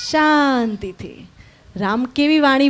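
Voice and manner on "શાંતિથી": very slow, softened, stretched word; calm, drawn out. Shown as a demonstration. Teacher showing children how Ram walks, calm drawn-out word. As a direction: stretch it out softly and peacefully.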